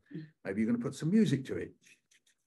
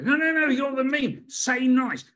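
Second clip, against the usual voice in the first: higher and louder, eager insistence; insistent fan; 11 semitones higher, 7 dB louder than usual. Retold or imitated. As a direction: pester him to say it. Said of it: "I said".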